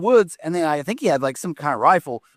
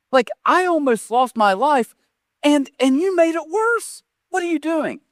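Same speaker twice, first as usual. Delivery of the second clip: exasperated outburst; 11 semitones higher than usual. Retold or imitated. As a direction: let it climb in disbelief at his brother. Retold outburst at brother.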